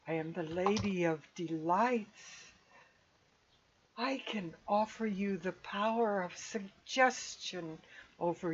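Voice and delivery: soft, measured temptress with weighty pauses; alluring Lady of Delights. Acted. Imprisoned spirit offering power to adventurers, soft and enticing. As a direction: softly tempt them, spacing out each phrase.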